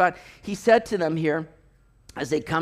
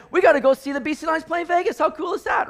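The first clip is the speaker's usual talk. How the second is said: excited buddy, high and quick; eager, rushed proposal to a pal; 9 semitones higher, faster than usual. Retold or imitated. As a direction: pitch it up, hyped for the game. Buddies hyped for road trip.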